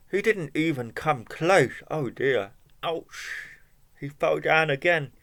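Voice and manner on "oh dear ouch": usual speaking voice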